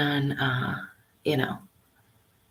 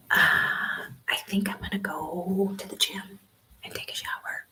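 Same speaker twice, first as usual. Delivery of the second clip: whispering; 3 semitones higher, 5 dB quieter than usual. Real, not acted. Live in a quiet library.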